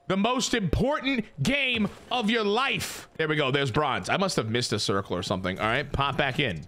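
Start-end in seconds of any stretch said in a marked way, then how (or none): 0.1-3.1 "The most important game of your life" stretched slow like a movie trailer; milk "most important game of your life" slowly for drama; dramatic slow repeat. Shown as a demonstration.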